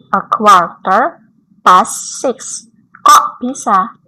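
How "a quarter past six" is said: very slow, louder, the British way; slow British reading. Shown as a demonstration. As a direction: model the British form word by word. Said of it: "kita artikan". (dibaca sangat pelan gaya Inggris)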